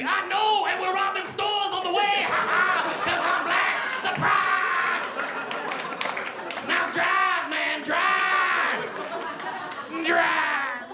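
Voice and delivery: high, excited robber shout; mock-menacing yell with stretched-out orders. Hopped-in passenger ordering the cab driver on, mock robber shouting.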